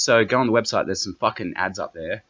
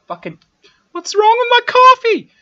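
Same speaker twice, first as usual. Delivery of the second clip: yelling out; 14 dB louder than usual. Retold or imitated. Calling out to A J from the kitchen, a loud high yell. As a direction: shout it across the house, much higher and louder. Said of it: "I'm sort of yelling out to fucking A J".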